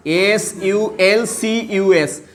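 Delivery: slow letter-by-letter spelling; measured dictation of letters. Shown as a demonstration. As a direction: spell it slowly for a class, each letter separate. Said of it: "देखो मैं बताता ये पर".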